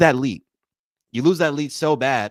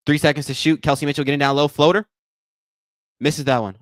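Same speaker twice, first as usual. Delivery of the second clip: louder running game call; live play-by-play; 4 dB louder than usual. Acted. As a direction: call the action as it happens.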